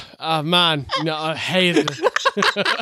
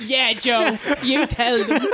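put-on joking Dublin accent through laughter; 4 semitones higher than usual. Mother cheering on Joe, laughing Dublin accent. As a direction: cheer him on in mock Dublin. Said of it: "in a Dublin accent".

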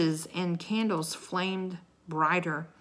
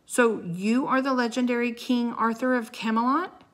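loud, higher, sneering question; mocking; 6 semitones higher, 5 dB louder than usual. A narrator's reading. Knight taunts the legendary king, mocking. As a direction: taunt him with mock grandeur. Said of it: "in a mocking voice".

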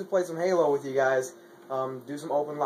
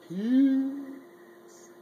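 high-pitched goodbye; 8 dB louder, much slower than usual. Acted. High sign-off.

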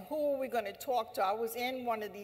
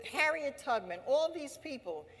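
ordinary talk

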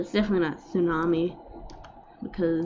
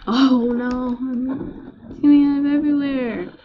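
alarmed; 6 semitones higher, 6 dB louder than usual. Real, not acted. Flood spreading in game.

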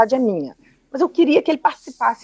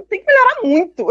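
laughing; 11 semitones higher, 3 dB louder than usual. Real, not acted. Laughs at her own shortcomings.

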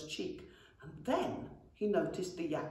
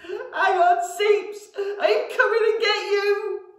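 much higher, loud sobbing cry; sobbing shout; 13 dB louder, slower than usual. A narrator's reading. Goblin sobs to brother inside dragon, shouting. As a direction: cry out through tears. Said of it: "he sobbed".

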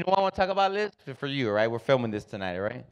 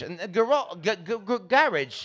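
"garage" stammered in an imitated English accent; mock English accent; 5 semitones higher, 5 dB quieter than usual. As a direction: fumble toward an English "garage", higher. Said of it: "to see her talk like that's weird".

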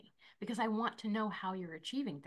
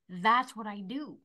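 usual speaking voice

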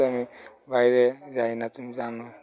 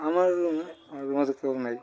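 normal talking voice

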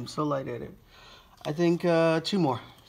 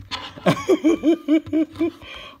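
high giddy laugh; exhausted happy laughter; real laugh; 13 semitones higher than usual. Real, not acted.

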